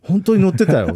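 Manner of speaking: high, loud delighted exclamation; excited message. Retold or imitated. Quoting the message he sent the engineer, excited and loud. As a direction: burst out with the thrilling news.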